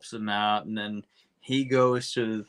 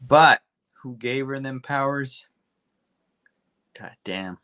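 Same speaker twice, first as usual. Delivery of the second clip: ordinary talk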